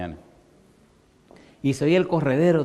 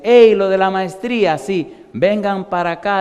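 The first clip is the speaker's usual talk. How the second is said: llamada alta y fuerte; llama al grupo desde lejos; llamando; 7 semitones higher, 8 dB louder than usual. Retold or imitated. Doctor Miller llama. (el doctor Miller llama a los alumnos, alto y fuerte)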